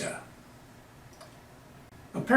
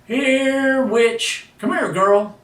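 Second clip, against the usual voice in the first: high, loud call to a dog; yelling; 10 semitones higher, 4 dB louder, much slower than usual. Acted. Soldier yelling for his dog at base camp, high and loud. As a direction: holler for the dog to come back. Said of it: "started yelling".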